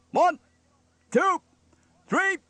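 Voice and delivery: very high, loud, separated counts; barked count-in. A narrator's reading. Steele counts in the band, barking. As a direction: bark each number with a gap.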